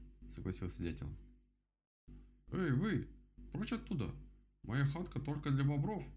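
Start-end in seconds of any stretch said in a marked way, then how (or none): 2.5-6.0 high, indignant shout. A narrator's reading. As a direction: shout them away from your hut. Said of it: "закричал Кастер". (возмущённый крик высоким голосом)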